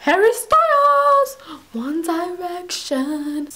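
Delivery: Jack. Acted. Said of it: "it's Jack".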